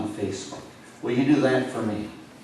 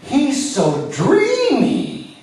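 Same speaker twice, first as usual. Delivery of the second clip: much higher, slow swooning squeal; hammed-up fangirl swoon; swooning fan; 13 semitones higher, 6 dB louder, much slower than usual. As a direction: gush like a starstruck fan. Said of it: "you're gonna scream".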